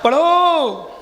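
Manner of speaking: long drawn-out call to the class; calling to recite. Real, not acted. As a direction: prompt the class, stretching the last vowel. (पाठ के लिए लंबी खिंची पुकार)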